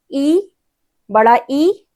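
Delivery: ई drawn out long, tongue toward the palate; slow palatal vowel. Shown as a demonstration. Teacher demonstrates ई for students to copy, slow and long. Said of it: "देखिए बोल के".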